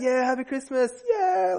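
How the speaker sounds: high, hammed-up cheer; dad playing along with a big mock whoop; mock celebration. Dad greets the boys on Christmas morning with mock cheer. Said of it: "like, celebration".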